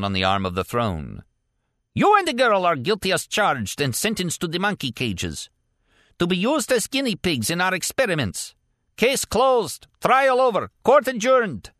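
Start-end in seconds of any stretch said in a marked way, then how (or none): 1.9-11.7 a mad judge slamming the case shut; warlord sentencing. A narrator's reading.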